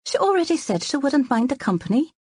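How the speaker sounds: high, indignant what, then a wounded rising plea; injured protest. Acted. Wife protests her husband's scolding, high and injured. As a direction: act hurt and surprised at being told off. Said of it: "She made an injured expression".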